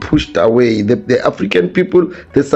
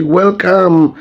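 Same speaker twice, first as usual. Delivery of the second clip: higher, slow mock welcome; 5 semitones higher, much slower than usual. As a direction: sarcastically roll out the red carpet for newcomers. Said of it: "and say".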